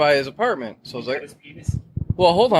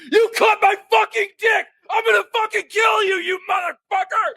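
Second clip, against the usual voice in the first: high-pitched screaming threat, imitating an attacker; enraged yell; 12 semitones higher than usual. Furious friend stabbing through the closet doors, high enraged yell.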